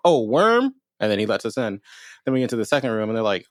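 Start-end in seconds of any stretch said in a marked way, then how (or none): 0.1-0.7 high, louder imitation of a taken-aback guard; mock surprise. Guard answers the visitors' password in recap, mock surprised. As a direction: play up the guard's startled question.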